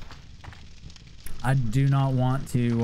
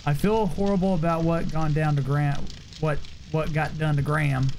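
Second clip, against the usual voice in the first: usual speaking voice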